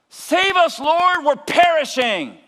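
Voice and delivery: high, loud, desperate plea; frantic disciples shouting in the storm; panicked cry. Acted. Disciples panic, wake Jesus.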